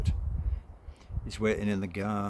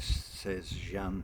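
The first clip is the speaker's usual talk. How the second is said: usual speaking voice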